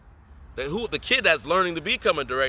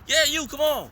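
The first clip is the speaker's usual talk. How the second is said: director voice; 10 semitones higher than usual. Retold or imitated. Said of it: "I'm like, "Who, me?".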